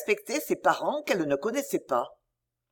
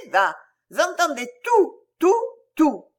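excited insistence; 8 semitones higher, 5 dB louder than usual. A narrator's reading. Child insisting she heard.

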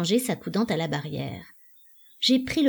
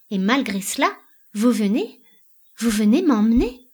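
loud incredulous rising questions; appalled disbelief; 3 semitones higher, 6 dB louder than usual. A narrator's reading. Married Elida challenges the stranger's claim, appalled.

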